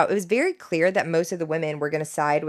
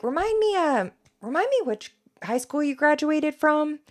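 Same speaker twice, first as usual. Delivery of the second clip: condescending lawyer; 8 semitones higher than usual. Retold or imitated. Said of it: "he's like".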